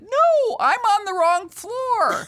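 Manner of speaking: high, slow, squeaky panicked fib; flustered excuse. Retold or imitated. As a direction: blurt it out in a high nervous voice. Panicked lie to receptionist.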